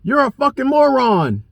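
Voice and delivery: high, slowed sneer of the insult; mocking the other driver. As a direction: spit the insult pitched high and slow. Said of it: "he comes around".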